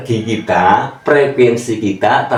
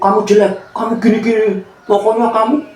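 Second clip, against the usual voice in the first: mildly put-on higher insulting voice; mock scolding; 9 semitones higher than usual. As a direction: scold someone in play, pitched up. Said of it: "ngomongnya negatif".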